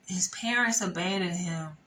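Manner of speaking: usual speaking voice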